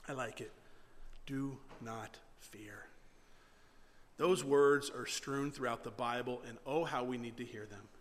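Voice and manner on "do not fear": hushed, low, very slow reassurance; calm. Acted. Prophet answering the frightened servant, calm. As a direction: soothe with each word spaced out. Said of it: "Elisha calmly responds".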